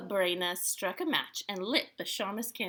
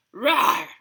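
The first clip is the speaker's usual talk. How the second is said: short, loud bear roar; bear growl; 3 semitones higher, 11 dB louder than usual. A narrator's reading. Old Bear answers the sour cream or jam question, growling. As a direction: bark out one growly animal roar. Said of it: "growled Old Bear".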